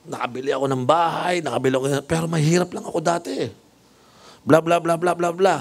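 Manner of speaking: high, proud boasting; bragging nurse voice. Retold or imitated. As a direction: show off the house, voice up high. Proud nurse brags about her house. (mayabang na nars, mataas ang boses)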